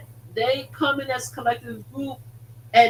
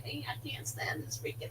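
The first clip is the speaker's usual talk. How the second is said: hushed whispered grumble, much softer; whispered complaint; 4 semitones higher, 16 dB quieter than usual. Retold or imitated. Grumbling at the slot she lost on, whispered. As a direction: vent under your breath. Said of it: "I'm like".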